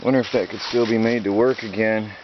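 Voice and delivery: usual speaking voice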